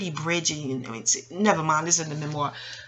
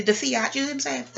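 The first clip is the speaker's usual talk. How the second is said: ordinary delivery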